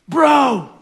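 loud, high excited bro shouting; frat bro. Acted. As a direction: yell like a hyped frat bro at midnight. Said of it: "this is how the story goes".

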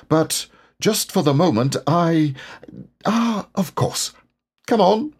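high storybook animal voice, breaking off then brisk; Rabbit. A narrator's reading. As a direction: pitch it well up, as if suddenly remembering.

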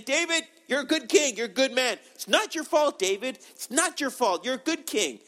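high, gushing flattery; mock reassurance. Imagined messenger from God comforting David, gushingly reassuring. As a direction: soothe him with exaggerated encouragement.

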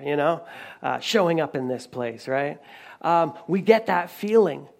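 usual speaking voice

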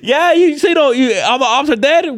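high, laughing mock voice; mocking complaint. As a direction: tease them high, laughing through it. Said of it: "the whole time I was like".

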